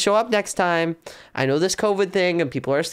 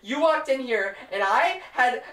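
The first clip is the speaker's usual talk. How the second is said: exasperated; 6 semitones higher than usual. Acted. Acted quarrel over embarrassing secret.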